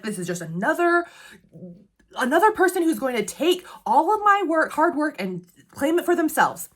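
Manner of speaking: indignant rant in a character's head; mock exasperation. Reader voices wary researcher's complaint about credit-stealers, mock exasperated. Voicing the heroine's exasperated inner thought.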